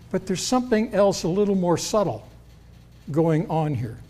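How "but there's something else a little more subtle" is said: ordinary talk, nothing marked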